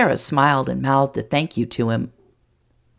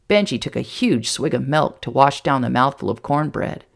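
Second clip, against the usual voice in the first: ordinary delivery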